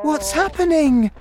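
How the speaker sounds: put-on high, loud little-girl voice; alarmed cry. A narrator's reading. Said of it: "Sophie cried".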